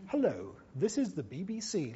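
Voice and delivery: soft, plummy Queen's English. Retold or imitated. Voice trainer demonstrating the BBC way of speaking, plummy Queen's English. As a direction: imitate a very plummy English accent. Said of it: "the plummiest Queen's English accent".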